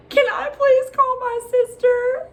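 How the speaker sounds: imitated desperate, high-pitched begging; mock panicked plea. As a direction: squeak it out like a hammed-up meltdown. Retold panicked plea to partner.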